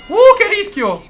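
high, loud whoop of alarm; startled exclamation. Real, not acted. Pilot narrowly avoids danger, exclaiming high. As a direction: cry out after a near miss. Near miss while flying.

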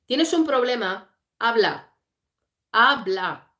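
loud, forceful acted challenge; mock confrontational demand. Retelling the jealous husband challenging his rival, loud mock demand. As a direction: demand an answer, each word pushed out hard.

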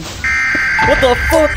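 high, drawn-out shocked cry; slow high stunned what the fuck; startled. Real, not acted. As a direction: pitch it way up in shock. Startled in dark game.

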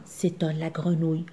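ordinary delivery

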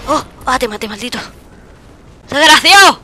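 loud insulting yell; furious gamer insult; angry shout. Real, not acted. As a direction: bark the insult at the enemy. Insulting enemy after kill.

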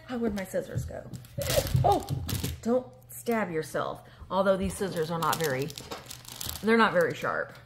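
normal talking voice

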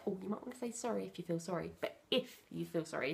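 soft, quick gentle prompting. Shown as a demonstration. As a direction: coax her softly to apologise. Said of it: "So prompt her, say".

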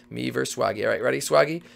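ordinary delivery

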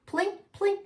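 high plink. Acted. Xylophone teeth.

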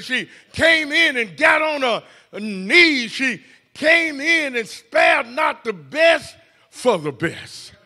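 the pastor hammers a refrain at the congregation; preaching. Acted. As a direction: hammer the refrain "she came in". Preacher repeating "she came in.

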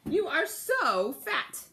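high, slow, scandalised lady; a lady with a parasol gasping at the cat, high and slow; lady with a pink parasol exclaims at the cat, high and shocked; shocked fine lady. Acted. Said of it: "too, said to him".

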